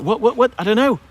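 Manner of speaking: high put-on voice for the dog; dog's voice. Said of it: "He's like".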